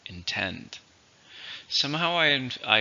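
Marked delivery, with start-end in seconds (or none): 0.1-0.8 "intend" with the stress on TEND; stress on second syllable. Shown as a demonstration. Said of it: "stress on the second".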